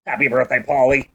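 low, flat creepy robot. Retold or imitated. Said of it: "creepy robotic male voice".

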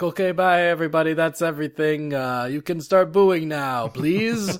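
high, loud, cheery send-off; Harada impression. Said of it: "He's like".

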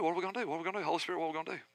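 retold whispered panic to the Holy Spirit; panicked prayer. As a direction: murmur it like a desperate prayer. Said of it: "I'm like".

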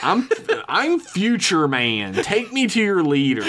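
hammed-up future man. Hammed-up time traveller quote.

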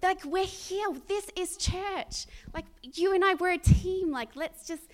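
rally a teammate with rising fervour; impassioned plea. Retold or imitated. Urging colleague Jermaine to bring the gospel, impassioned.

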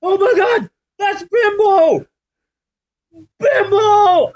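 high, loud fanboy shriek of joy; excited shout. Retold or imitated. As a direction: yell it like a wrestling fan marking out. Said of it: "I marked out like I was at WrestleMania".

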